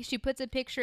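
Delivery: normal talking voice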